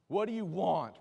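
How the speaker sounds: loud, high mock challenge; suspicious challenge. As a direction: blurt it out like you distrust the stranger. Acts suspicious reply to friendly stranger.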